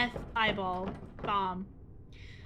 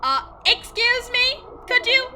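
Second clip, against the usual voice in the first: much higher, louder, slow call for attention; 11 semitones higher, 8 dB louder, slower than usual. Pretend. Player calling out loudly to get the game character's attention. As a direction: hail someone who isn't listening. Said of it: "You don't need to shout".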